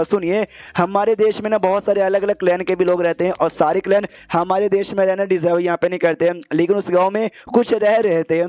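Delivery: ordinary delivery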